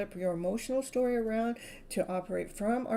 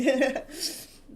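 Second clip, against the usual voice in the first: high, loud rising laugh; real laugh that rises and rings; signature laugh; 6 semitones higher, 7 dB louder than usual. Real, not acted. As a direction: let your one-of-a-kind laugh ring out. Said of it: "Yeah, my laugh".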